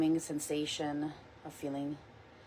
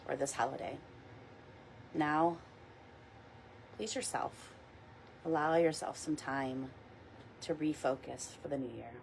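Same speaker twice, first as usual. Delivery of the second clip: soft, unhurried meditation guide voice; gentle guided meditation read; 4 dB quieter than usual. Acted. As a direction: ease the listeners into calm. Leads a weekly guided meditation.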